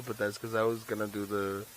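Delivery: normal talking voice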